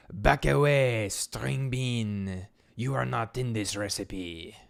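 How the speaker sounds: put-on loud, drawn-out French-accented chef. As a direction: bellow it as a French chef villain. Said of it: "Oh, wait, he's French".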